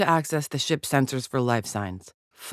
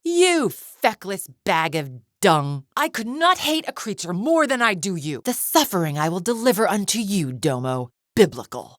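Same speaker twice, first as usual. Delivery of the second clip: high, indignant tirade; outraged, high-pitched vow of vengeance; furious contempt; 4 semitones higher than usual. Acted. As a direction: spit insults at a hated rival. Villain threatens and insults rival.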